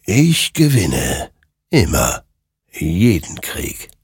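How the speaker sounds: slow, looming, final declaration; creature voice. Acted. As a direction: pronounce it like death itself.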